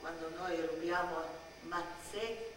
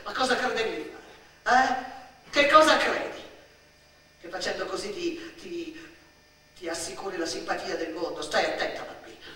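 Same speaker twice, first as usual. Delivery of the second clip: loud, sharp rebuke; stern scolding; 8 dB louder than usual. Acted. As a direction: warn the girl, biting and impatient. Adult keeps scolding the child. (rimprovero severo e tagliente)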